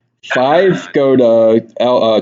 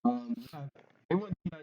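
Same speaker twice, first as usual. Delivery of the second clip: muffled voice; 3 semitones higher, 21 dB quieter than usual. Real, not acted. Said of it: "I hear a very muffled voice".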